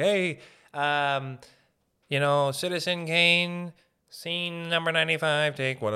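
a touch of a slate operator announcing the take; mildly hammed film-set announcer; Hollywood clapper call. Imitating a film crew slating a scene, mild announcer voice. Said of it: "they do the Hollywood".

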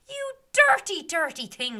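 very high, loud snap of disgust; disgusted scold. Acted. Meg scolding the fairies about the mess, high and loud. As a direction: spit it out in disgust.

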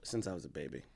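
soft whispered reply. Real, not acted. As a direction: whisper it back. Whispered answer, unvoiced.

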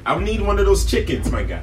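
loud, hammed-up Brooklyn chef; Brooklyn accent. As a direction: holler it like a Brooklyn Italian chef. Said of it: "he has a Brooklyn accent".

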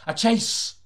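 high, loud burst of thrill; excited. Acted. Detective narrator thrilled at the chase, high loud burst. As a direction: shout it like the game is on.

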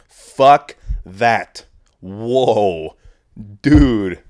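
loud, drawn-out disbelief; floored and emphatic; stunned outburst. Real, not acted. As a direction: react in shock, hitting each word hard. Reacting to pilotless plane news.

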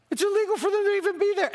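high, rapid outburst; incredulous exclamation. Real, not acted. Pastor marvelling that the church is illegal, incredulous and high.

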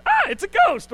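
high, loud mock cry of a ghost; hammed-up terrified disciple; mock scream. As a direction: yell it with mock fright.

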